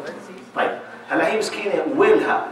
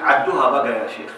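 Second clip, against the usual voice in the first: Egyptian dialect; 7 semitones lower than usual. Retold or imitated. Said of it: "على قولة إخواننا المصريين".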